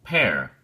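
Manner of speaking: pair said alone, firm and falling; teacher isolating the first syllable; isolated stressed syllable. Shown as a demonstration. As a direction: pull out pair on its own. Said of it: "stress is on the first syllable".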